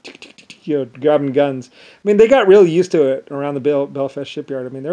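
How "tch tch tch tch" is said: whispered tut-tut clicks; soft tongue clicks. Retold or imitated. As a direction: click your tongue softly. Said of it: "the Irish are like".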